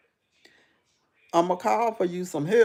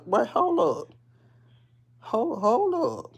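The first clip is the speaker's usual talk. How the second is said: startled; 5 semitones higher, slower than usual. Retold or imitated. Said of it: "And I said".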